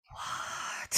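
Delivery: a breathless, whispered what; shocked into a whisper; stunned whisper. Retold or imitated. Isis stunned by the confession.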